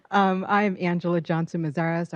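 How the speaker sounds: loud projected introduction; thespian voice. Real, not acted. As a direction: announce yourself like a stage actor. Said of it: "I'll use my thespian voice".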